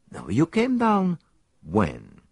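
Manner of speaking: lightly voiced Belgian detective; a hint of a fussy foreign sleuth; Poirot. Detective tells his friend where they will stay, lightly voiced.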